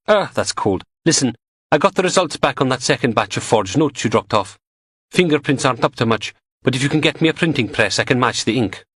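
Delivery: brisk pathologist sharing news; lightly voiced Samantha. A narrator's reading.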